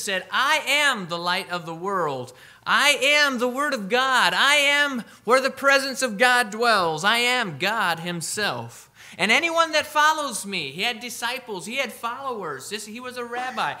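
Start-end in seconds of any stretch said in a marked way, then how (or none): 0.3-10.7 rising, louder proclamation as Jesus; fervent preaching. Acted. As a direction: build the claims up with fervour, higher and louder. Preacher voicing Jesus' claims.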